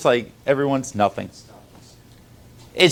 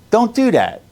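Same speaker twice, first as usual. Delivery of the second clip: higher, louder Don't do that, insisting; louder repeat; 7 semitones higher, 4 dB louder than usual. Real, not acted. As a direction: raise the voice on the repeat.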